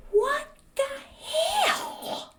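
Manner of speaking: very high, drawn-out mock shock; shocked outrage. Vlogger reacting to Texas comment, high theatrical outrage. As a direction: squeak it in scandalised disbelief.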